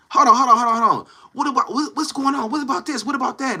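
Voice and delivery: high-pitched, repetitive mock objection; mimicked man scrambling to object, voice up high; imitating flustered protest. Acts out his flustered protest.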